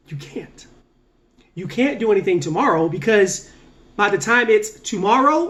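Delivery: ordinary delivery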